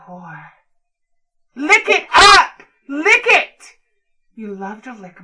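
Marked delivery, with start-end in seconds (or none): 1.5-3.7 high, loud order, slowed on the first; barked command. Acted. Dominant to partner, a high loud command. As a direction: shout the order down at them.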